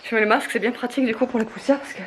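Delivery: slightly winded from the stairs; out of breath. Real, not acted. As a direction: talk while catching your breath. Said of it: "je suis un peu essoufflée".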